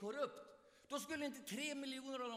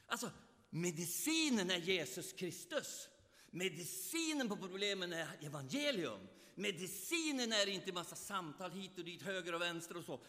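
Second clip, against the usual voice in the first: usual speaking voice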